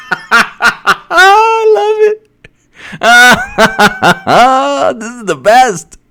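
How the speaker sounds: high, drawn-out cackling delight; high-pitched, helpless glee; delighted laughter. Real, not acted. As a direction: laugh right through the words. Cracking up at what was read.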